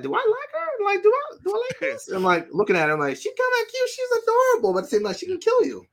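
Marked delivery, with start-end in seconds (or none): 0.0-2.1 very high squeaky self-questioning; mock puzzlement. Viewer unsure if she likes her, high-pitched mock puzzlement. Acting out torn inner reaction. 3.2-5.8 very high squeaky musing; mock torn admiration. Viewer torn over a deadly character, high-pitched musing. Acting out torn inner reaction.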